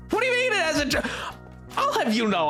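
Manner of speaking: ordinary talk, nothing marked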